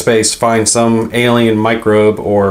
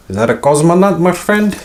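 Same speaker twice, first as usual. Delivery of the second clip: a deliberately bad, hammy Russian accent, pitched higher; 7 semitones higher than usual. Unboxing a space game, joking to viewers in a bad Russian accent. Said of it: "My bad Russian accent".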